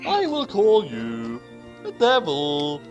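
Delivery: ordinary talk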